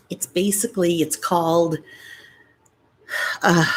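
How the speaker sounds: ordinary talk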